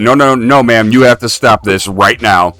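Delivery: firm warning. Retold or imitated. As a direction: talk her down firmly before it spreads. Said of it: "I'm like".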